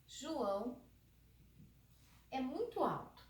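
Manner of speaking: "muito" lifted in a slow example read; example sentence. Shown as a demonstration. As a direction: set out "João é muito alto" with pauses. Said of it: "Se eu falar pra você assim".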